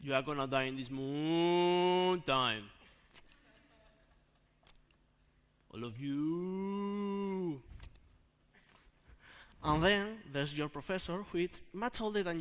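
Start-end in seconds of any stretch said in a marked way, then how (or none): 0.0-7.6 long drawn-out moo on the vowels; mooing cow. Acted. Cow staring at exhausted students, ominous mooing. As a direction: stretch the words into slow moos. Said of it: "a cow".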